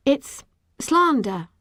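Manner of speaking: prim, self-conscious pronouncement; thin and precise. A narrator's reading. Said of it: "My voice sounded thin and precise".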